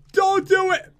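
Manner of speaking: high-pitched, urgent plea; real high yelp of warning; alarmed cry. Real, not acted. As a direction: cry out to stop an attack. Panicking as slimes attack.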